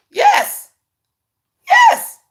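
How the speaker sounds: very high, loud exclamation; a shrill-free high burst of agreement; emphatic yes. Real, not acted. Mother cheering her own point, high exclamation.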